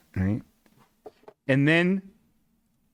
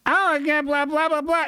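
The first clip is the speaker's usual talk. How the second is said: mock lady; 12 semitones higher than usual. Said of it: "she's like".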